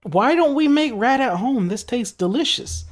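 hammed-up childlike delight; mock kid voice. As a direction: play your younger self loving rat nuggets. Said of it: "I was like".

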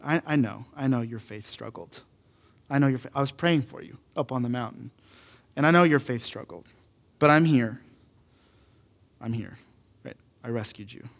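soft, low, tender reassurance; quiet, understanding comfort; loving father. Acted. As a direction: reassure gently, with pauses to let it land. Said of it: "voice of a loving father".

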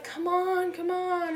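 high-pitched mock crowd pleading; eager urging. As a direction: urge them on as the watching kids, high. Kids urge on a kiss.

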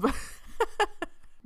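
airy laugh, pitch up; breathy giggle. Real, not acted. Laughs after tipsy remark.

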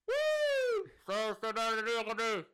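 very high, loud wailing whoop; police siren. Acted. Ocean police chasing the ship, mock siren and yelling. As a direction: imitate a siren, then garbled loud hailing.

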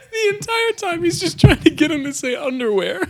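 high, laughing through tears; barely getting it out laughing; crying with laughter. Real, not acted. Narrating while crying with laughter.

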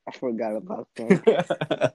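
slow, loud words through laughter; laughing. Real, not acted. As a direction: laugh while you say it. Laughing at shared memory.

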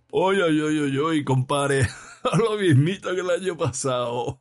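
high, jeering imitation breaking into laughs; choking with laughter, mocking. A narrator's reading. Arrested Rastrojo mimicking his companion Bayonetas, laughing mockery. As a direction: mimic your pal's joking singsong, laughing. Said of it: "imitando la voz y el tonillo de chunga".

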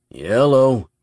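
loud, drawn-out hello; a hint of a clownish receptionist; jokey phone greeting. A narrator's reading. Friend answers the phone, hammed-up greeting.

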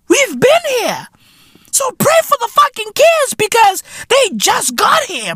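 high, shouted lament; mock despairing shout. Real, not acted.

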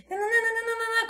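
resmungo caricato, bem agudo e repetitivo; reclamação brava imitada. Retold or imitated. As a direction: imite alguém reclamando em tom alto. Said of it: "começou a gritar, gritar não, falar assim, brava, reclamando". (reclamação brava arremedada, fininha)

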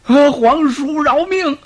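high, slow wailing plea; begging for mercy. Acted. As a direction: beg loudly for your life. Condemned general begs for life.

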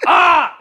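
short, loud, high scream; angry yell. Retold or imitated. Letting anger out alone while driving, angry scream. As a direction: burst out with a frustrated "ah". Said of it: "I yell in the car to no one".

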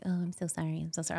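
very soft imitated apology; meek apology. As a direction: mimic her sudden backing down, quietly. Retold sudden turnabout, visitor apologizes.

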